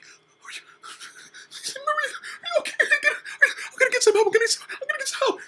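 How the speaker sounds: high, loud, frantic panic; high frantic child's panic; panicked kid. Retold or imitated. Kid panicking over Maria in pain.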